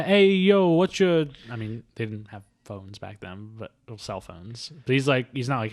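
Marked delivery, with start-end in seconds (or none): 0.0-1.3 loud, high catcalling tough guy; street flirt voice.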